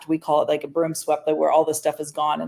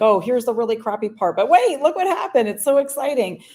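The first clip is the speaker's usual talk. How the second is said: high, hammed-up TV excitement; mock a breathless reality-show host; TV host hype; 6 semitones higher, 3 dB louder than usual. Mocking hoarder TV shows, high hammed-up hype.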